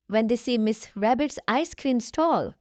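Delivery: ordinary delivery, nothing marked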